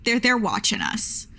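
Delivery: ordinary talk, nothing marked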